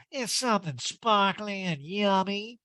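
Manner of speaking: high slow drawl; Erica impression. Erica describing her ring, high drawling voice. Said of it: "Erica goes".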